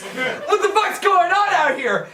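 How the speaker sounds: high, slow feigned bewilderment; clueless neighbor. Retold or imitated. Dad feigning innocence to the bros, clueless neighbor voice. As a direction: play innocent, pitched well up. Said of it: "like a clueless neighbor who didn't do this".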